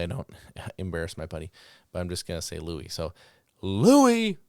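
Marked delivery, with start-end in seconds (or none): 3.6-4.4 high, loud call of the name across the field; ringing field call to a dog. Retold or imitated. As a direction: sing out the dog's name to send him. Handler calling dog by name.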